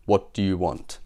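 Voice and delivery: low, gloomy soldier; mock-gloomy guard's question. A narrator's reading. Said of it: "he asked gloomily".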